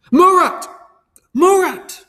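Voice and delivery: high, loud call across the lobby; shouting a friend's name across the lobby. A narrator's reading. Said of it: "I called out".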